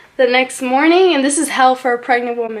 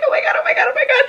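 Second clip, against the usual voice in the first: much higher, laughing half-breathless oh my God; laughing panic; much faster than usual. Retold or imitated. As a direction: squeal it through nervous laughter. Needle going into her back.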